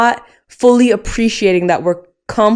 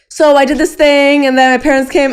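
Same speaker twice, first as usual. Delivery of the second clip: breezy brush-off; 7 semitones higher, 4 dB louder than usual. Retold or imitated. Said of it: "I was like".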